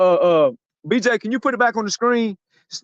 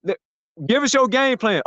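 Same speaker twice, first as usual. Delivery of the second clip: ordinary delivery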